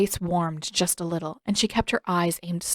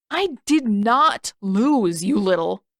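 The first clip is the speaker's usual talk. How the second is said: slow, loud, spluttering denial; indignant protest; 3 semitones higher, 6 dB louder, slower than usual. Acted. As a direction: bite out each word in protest. Sokka cut off mid-insult.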